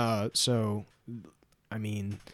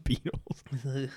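laughing; 4 dB quieter than usual. Real, not acted. Laughing at [name] joke.

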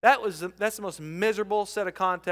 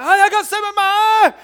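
higher, louder, fast complaint; mock distressed husband; 11 semitones higher, 11 dB louder, faster than usual. Husband telling his wife about the dust, hammed-up distress. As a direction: blurt it out in a pained rush. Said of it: "I said".

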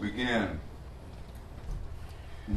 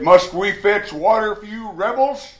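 loud, high scolding of the rebels; angry Moses; 10 semitones higher, 10 dB louder than usual. Retold or imitated. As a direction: snap at the grumbling crowd. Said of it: "Moses was quite upset".

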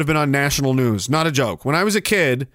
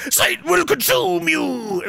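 higher, slow mock demon voice that cracks; imitating cracking Satan; 10 semitones higher, slower than usual. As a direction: ham up a would-be devil whose voice breaks like a nervous middle schooler. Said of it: "his voice cracks like a nervous middle schooler".

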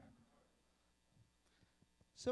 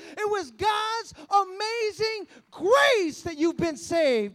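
much louder and higher, stretched out on grace; preaching at full force; 7 semitones higher, 13 dB louder than usual. Acted. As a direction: drive it home to the congregation with full force.

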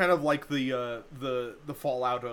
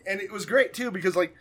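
normal talking voice

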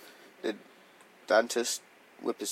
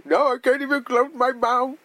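higher, mumbling mock complaint; mocking friend; 9 semitones higher, 4 dB louder than usual. Friend mocking him after dental work, higher whine. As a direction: mimic him numb after the dentist. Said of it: "he'll be like".

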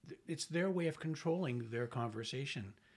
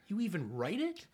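higher, louder amused disbelief; mock surprise; 8 semitones higher, 6 dB louder than usual. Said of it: "he says".

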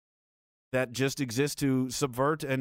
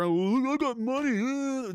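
higher, sneering braggart voice; mock rich braggart; 9 semitones higher, slower than usual. Mocking rich people, a hammed-up boast about money. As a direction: lightly play a smug rich guy showing off.

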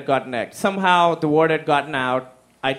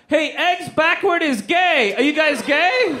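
a much higher, slow, goofy taunt; desperate taunt; 8 semitones higher than usual. Retold or imitated. Teen turning back on the egg throwers, in a much higher slow taunt. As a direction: fling a lame comeback while running away. Last-ditch taunt while fleeing.

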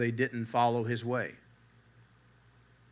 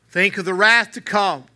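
higher, louder cry with "wrath" hit hard; exclaimed warning; 6 semitones higher, 9 dB louder than usual. Retold or imitated. Preacher crying out to his hearers, loud and high. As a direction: ring out the warning from the pulpit. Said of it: "he exclaimed".

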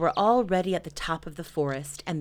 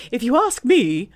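loud, higher, swooping owl voice; self-important Owl; 7 semitones higher, 9 dB louder than usual. Acted. Owl starts advising Eeyore, loud and self-important. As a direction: offer an opinion grandly. Owl offers his opinion.

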